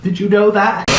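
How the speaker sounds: ordinary talk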